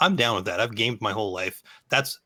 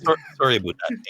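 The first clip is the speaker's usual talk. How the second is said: higher, hammed-up Canadian apology; Canadian accent; 5 semitones higher, 4 dB louder, faster than usual. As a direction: lean hard into Canadian vowels. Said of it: "here comes that Canadian accent".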